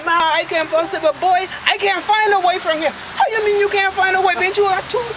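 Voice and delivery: normal talking voice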